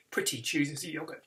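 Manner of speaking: usual speaking voice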